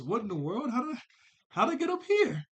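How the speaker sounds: put-on high, baffled kid voice, jumping up at the end; mock bewildered Tommy; startled kid. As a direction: wake up lost and panicking. Tommy waking on statue.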